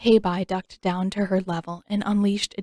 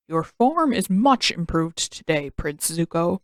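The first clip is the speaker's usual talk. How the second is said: Uncle voice; 3 semitones lower than usual. Acted. Uncle praising nephew.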